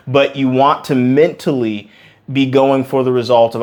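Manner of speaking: ordinary talk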